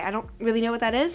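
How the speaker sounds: ordinary talk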